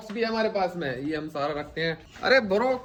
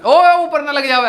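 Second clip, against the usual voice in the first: calling out; 7 semitones higher, 7 dB louder than usual. Real, not acted. Calling out warning.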